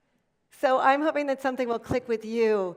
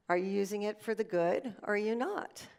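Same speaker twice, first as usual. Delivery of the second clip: usual speaking voice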